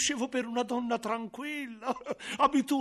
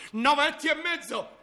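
high, loud announcement of the weight; butcher's shout; 6 semitones higher, 5 dB louder than usual. Acted. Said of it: "grida il macellaio".

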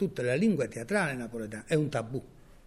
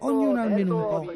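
higher and louder, a ringing stage call on Ognuno; first call of the refrain, loud and high; loud declaiming; 8 semitones higher, 7 dB louder than usual. Acted. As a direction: throw the line out to the back row. Repeated line in recitation.

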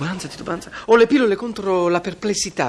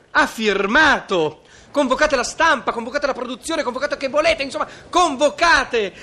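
excited shouting; 8 semitones higher than usual. Acted. Producer exults at the signing.

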